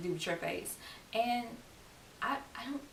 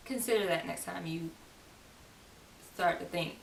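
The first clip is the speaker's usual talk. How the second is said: ordinary talk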